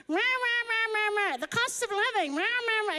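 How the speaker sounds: high, loud, mocking rah-rah moan; mock complaining babble. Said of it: "I'm like".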